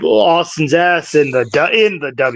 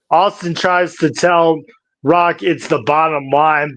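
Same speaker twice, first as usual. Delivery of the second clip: ordinary talk, nothing marked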